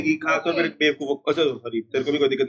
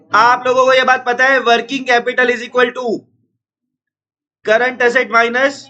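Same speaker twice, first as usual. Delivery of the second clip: raised, high, forceful classroom voice; shouting to teach; 5 semitones higher, 4 dB louder, much slower than usual. Real, not acted. Teacher explaining working capital to noisy students, shouting. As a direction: project loudly over a chattering class, pitched up. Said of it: "फिर मुझे चिल्ला के बोलना पड़ता है".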